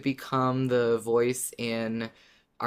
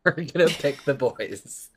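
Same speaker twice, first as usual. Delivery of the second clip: laughing. Real, not acted. Laughs while finishing point.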